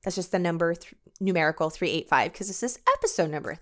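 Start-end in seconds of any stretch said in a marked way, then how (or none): none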